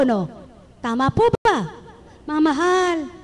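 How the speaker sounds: ordinary delivery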